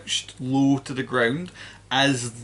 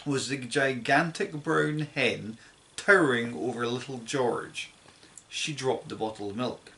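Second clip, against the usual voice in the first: plain reading voice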